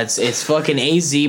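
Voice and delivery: usual speaking voice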